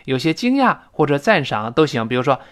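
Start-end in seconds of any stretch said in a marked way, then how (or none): none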